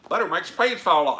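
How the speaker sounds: high, loud, quick Southern catchphrase; fake Southern accent. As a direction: holler it in a hammed-up Southern twang. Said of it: "do it in a Southern, fake Southern accent".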